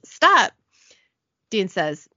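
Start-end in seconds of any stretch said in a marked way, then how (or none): 0.1-0.5 short, high, loud shout; acted shout of protest; yell. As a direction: yell to halt a fight. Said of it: "Missus McKinley yells".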